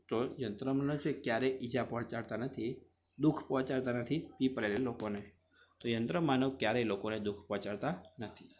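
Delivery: normal talking voice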